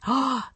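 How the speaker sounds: quick gasp of inspiration; sudden gasp. Acted. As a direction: gasp as an idea strikes. Sudden idea in read story.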